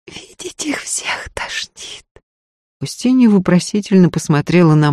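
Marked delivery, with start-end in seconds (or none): 0.1-2.1 slow, high whispered moan. A narrator's reading. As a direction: drag it out in a faint, anguished whisper. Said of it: "коротко простонала та".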